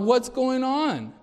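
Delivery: high, slow alarmed parent; a startled dad calling into the room; imitating a dad walking in on screaming; parent checking in. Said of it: "I'm like".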